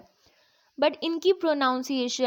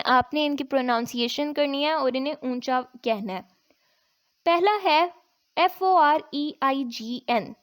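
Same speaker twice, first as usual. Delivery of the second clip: ordinary talk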